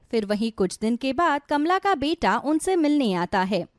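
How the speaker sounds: plain reading voice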